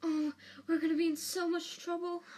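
very high, anxious wail; acted panicky whimper; frightened whimper. A narrator's reading. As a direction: whimper with dread, pitched far up. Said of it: "Hermione whimpered".

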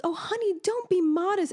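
high, gushing "oh honey" mother-in-law voice; a proud mom bragging on her, voiced way up high; doting mother-in-law. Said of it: "my mother-in-law goes".

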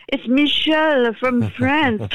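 slow, high mock French accent. Caller from Paris in a slow high French accent. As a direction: put on a heavy French accent for Michel. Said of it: "He goes".

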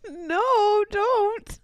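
high, loud protest with laughter; alarmed protest. Real, not acted. Host stopping co-host inviting an intruder, laughing alarm. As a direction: cry out to stop them, laughing.

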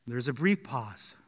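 ordinary delivery

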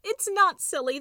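high, loud tearful protest; squeaky, upset objection. A narrator's reading. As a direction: protest like a wounded little mouse. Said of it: "his tears splashing onto the pavement".

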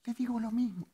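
soft, high-pitched aside; gentle, hushed point; hushed emphasis. Real, not acted. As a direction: say it lightly and quietly. Hushed aside marking same words.

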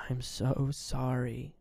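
soft, slow and drawn out; mock tearful apology. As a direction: imitate a quietly tearful apology. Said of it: "he's crying a little bit".

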